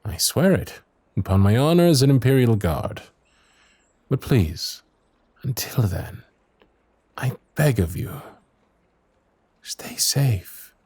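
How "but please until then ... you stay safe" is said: pleading whisper. Acted. Pleading farewell to princess.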